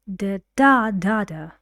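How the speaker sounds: da da da tapped out in the sentence's stress rhythm, first da loud; rhythm with da syllables. Shown as a demonstration. Teacher showing students the rhythm with da syllables. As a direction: speak the rhythm in nonsense syllables. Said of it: "you can use da, da, da, da".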